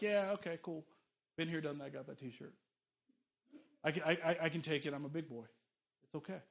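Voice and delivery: soft, casual, unbothered; relaxed God. Acted. God answers a complaint casually and softly. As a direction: shrug it off gently.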